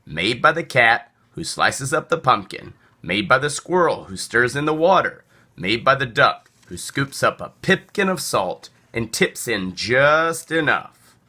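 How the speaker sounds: ordinary delivery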